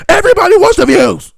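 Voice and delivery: very high, full-blast screech; mock screaming. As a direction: scream it to parody attention seekers. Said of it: "So why are you screaming?".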